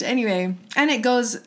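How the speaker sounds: ordinary delivery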